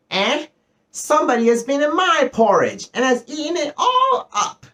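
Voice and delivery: very high, tiny bear squeal; little wee bear voice. A narrator's reading. Little wee bear finds his porridge all gone, little wee voice. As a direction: shrink it to a sharp, shrill little voice. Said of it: "said the little wee bear in his little wee voice".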